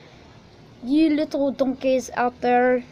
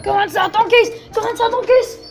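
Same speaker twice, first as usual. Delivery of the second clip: high, loud, urgent shouts; panicked yelling; 8 semitones higher, 6 dB louder, faster than usual. Real, not acted. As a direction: yell for everyone to flee inside. Fleeing fireworks in a panic.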